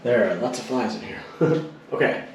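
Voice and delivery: normal talking voice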